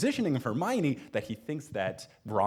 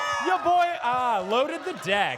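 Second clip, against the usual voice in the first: excited shout; 12 semitones higher, 5 dB louder, slower than usual. Real, not acted. Crowd all Gryffindor.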